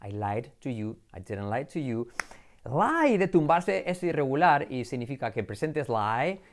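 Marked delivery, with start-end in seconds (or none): none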